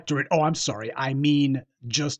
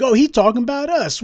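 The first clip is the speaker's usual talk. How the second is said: much higher and louder, fooled and delighted; gullible listener; 9 semitones higher, 6 dB louder than usual. Pretend. Commentator voicing a fooled voter, high and eager. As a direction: play the voter who falls for it.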